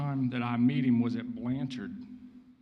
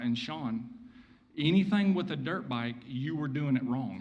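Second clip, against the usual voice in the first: ordinary talk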